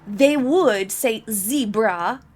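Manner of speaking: zebra with a short e, zeb-ra, said slowly; British zebra. Shown as a demonstration. Commentator demonstrates how the kids would say zebra, British pronunciation. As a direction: mimic a British child saying zebra. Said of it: "contrasting zebra pronunciation".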